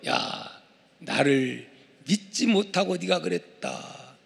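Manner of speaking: soft, slow reproach; God's quiet rebuke. Acted. Preacher voicing God rebuking Moses for unbelief, quiet reproach. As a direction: let the disappointment come out slowly and softly. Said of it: "화가 나서 한 거지만".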